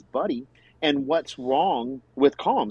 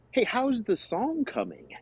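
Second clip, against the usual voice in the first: higher, slow, softer, falsely cheerful; mock-friendly Patrick voice; imitating Patrick; 10 semitones higher, 4 dB quieter than usual. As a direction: tiptoe in with a bright polite question. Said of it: "then Patrick was like".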